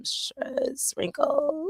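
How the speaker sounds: very slow, unvoiced whisper; joking about stress lines; whispered punchline. Real, not acted. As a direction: drag it out in a whisper.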